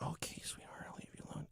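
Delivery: whispered mock reassurance; put-on bedside whisper; hushed consultant. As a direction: whisper it soothingly to a tired patient. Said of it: "then she's like".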